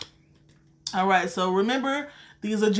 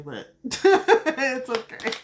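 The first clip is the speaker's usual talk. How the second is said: slow, laughing through it's okay; chuckling at her own mistake; 4 semitones higher, 3 dB louder, much slower than usual. Real, not acted. Laughs at shuffling wrong.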